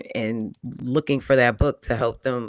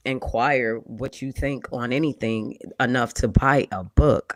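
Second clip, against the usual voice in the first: usual speaking voice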